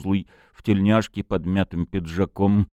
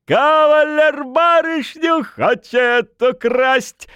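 put-on high, drawn-out drunken holler; loud, slow rowdy yell; shouting; 6 dB louder, slower than usual. A narrator's reading. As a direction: bellow it up at the window, stretching the words. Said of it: "крикнул парень".

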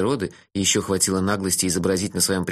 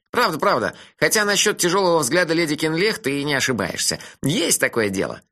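put-on cheeky dreamy young man, higher; teasing friend, pitched up; higher voice for Melifaro; 6 semitones higher than usual. (насмешливый голос Мелифары повыше)

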